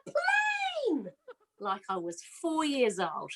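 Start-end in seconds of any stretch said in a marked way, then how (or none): 0.1-1.1 put-on high, slow, childlike cry of delight; excited yell. Yelling at a plane overhead, thrilled like a little kid. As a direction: shout it like a four-year-old who just saw a plane. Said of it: "I just yelled".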